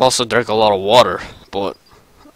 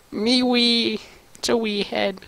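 high, softer squeaky Scots; high squeezed voice; 9 semitones higher, 10 dB quieter than usual. Acted. Demoman comments on a wee head, high and soft. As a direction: shrink the voice up high and small.

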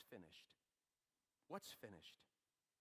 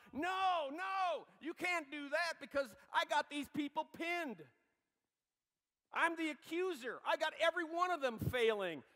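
high, loud, frantic accuser's shout; Satan protesting; 13 semitones higher, 16 dB louder than usual. Acted. Preacher voicing Satan yelling at God, loud and frantic. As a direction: shout it as the devil losing his grip. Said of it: "Satan is probably saying to himself".

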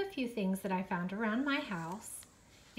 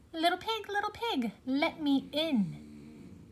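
high, drawn-out wolf voice; sly wolf voice; 7 semitones higher than usual. A narrator's reading. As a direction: coax sweetly, stretch it out. Wolf at brick house.

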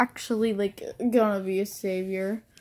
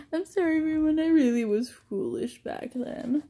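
high-starting mock apology; lightly voiced sorry figure; apologetic character; 6 semitones higher than usual. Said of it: "And then this one's just".

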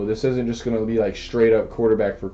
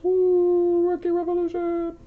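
high gleeful gloating cry; excited whoop; 3 dB quieter than usual. Real, not acted. Gloating to his friend about the better deck. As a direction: crow over the win.

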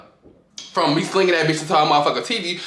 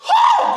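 a loud, very high exhale of relief; whew; 4 dB louder than usual. Real, not acted. Catching breath after telling the fight, loud high whew. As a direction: blow it out like you survived something.

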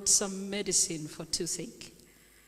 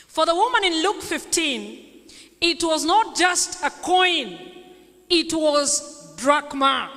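raised, ringing preacher's delivery; higher, louder sermon voice with strong stresses; emphatic preaching; 4 semitones higher, 7 dB louder than usual. Real, not acted. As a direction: teach the congregation forcefully, punching key words. Preaches on the parable.

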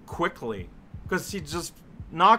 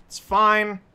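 louder, higher, forced "It's fine"; 4 semitones higher, 5 dB louder than usual. Real, not acted. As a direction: insist "It's fine" through frustration. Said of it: "You know what?".

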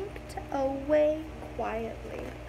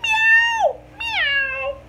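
very high, loud squeaky meow; piercing high kitten mew; 6 dB louder than usual. A narrator's reading. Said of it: "all they could go was".

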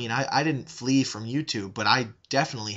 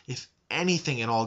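normal talking voice

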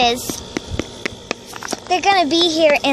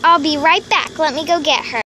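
usual speaking voice

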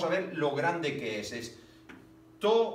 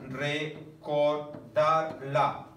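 slow syllable reading; much slower than usual. Shown as a demonstration. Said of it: "Una, dos".

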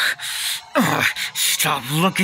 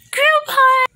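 high, loud excited cheer; gleeful call; 7 dB louder than usual. Acted. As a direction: call everyone in with glee.

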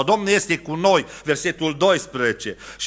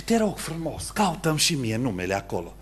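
soft, lower, small-voiced plea; timid pleading; 4 semitones lower, 6 dB quieter than usual. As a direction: beg like a man with a heart the size of a flea. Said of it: "cu inima cât un purice". (rugăminte timidă, cu voce mică și încet)

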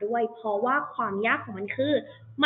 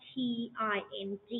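spelling out letters; 9 dB quieter than usual. Shown as a demonstration. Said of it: "practice ด้วยการเขียน".

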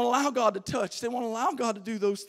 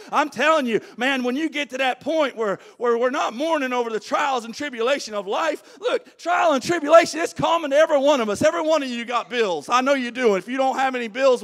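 preaching; 4 semitones higher, 5 dB louder than usual. Acted. Preaching at full force.

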